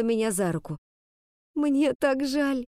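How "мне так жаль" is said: slow, drawn-out moan of regret. Acted. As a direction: moan it out slowly. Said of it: "простонала она".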